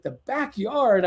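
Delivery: retold baffled question, no shouting; confused echo. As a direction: echo it in bewilderment. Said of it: "so confused".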